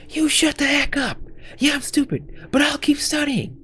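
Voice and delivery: very high-pitched, squealed retort; hammed-up outraged comeback; indignant character. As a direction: fire back in a squeaky, high voice. Acted character snaps back in quarrel.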